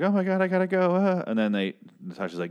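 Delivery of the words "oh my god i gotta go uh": much higher, louder, mock-panicked squeal. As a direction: imitate her flustered rush to leave, pitched way up. Retold [name] quote, flustered exit.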